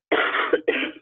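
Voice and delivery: harsh coughing fit; chesty cough. Real, not acted. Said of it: "sorry, I have a co-, cough".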